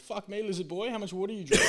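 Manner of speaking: high-pitched astonished koala; amazed koala punchline. Acted. Stoned koala mistakes crocodile for lizard, high mock astonishment. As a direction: deliver the punchline in a put-on high voice.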